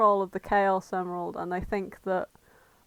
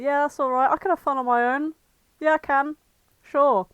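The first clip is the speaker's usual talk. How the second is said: lightly acted, higher-pitched carefree kid; breezy self-reassurance; 5 semitones higher, 4 dB louder than usual. Sonic telling himself he can have fun alone, breezy. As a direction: brush it off in a raised voice. Sonic voiced, brushing off loneliness.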